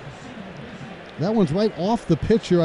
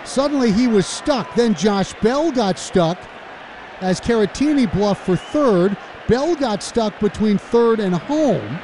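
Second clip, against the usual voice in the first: excited play-by-play; 7 semitones higher than usual. Real, not acted. Radio announcer to listeners, calling the messy play, excited rising shout. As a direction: let it build into a near-shout as the runners get caught. Live call of botched double play.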